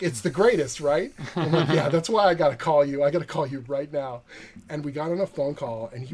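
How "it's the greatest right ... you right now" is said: louder, higher, eager; excited agreement. Retold or imitated. Two writers gushing on the phone, excited and loud. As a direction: gush about the movie. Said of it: "And he's like".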